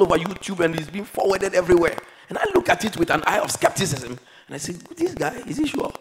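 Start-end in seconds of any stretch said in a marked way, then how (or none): 4.7-5.9 sceptical disbelief. Retold or imitated. Sceptical retold remark.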